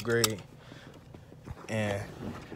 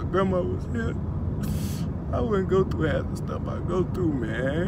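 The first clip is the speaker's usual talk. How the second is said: higher, strained voice on the edge of crying; holding back tears; 7 semitones higher than usual. Real, not acted. Boy missing his late grandma, voice straining against tears. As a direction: push words out past a lump in the throat. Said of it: "I'm trying not to cry".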